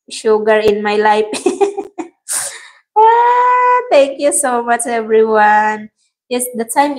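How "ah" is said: long high-pitched "aah"; high squeal. Real, not acted. Laughing at a viewer's comment, high squealed "ah". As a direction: squeal out a sustained "ah" after laughing.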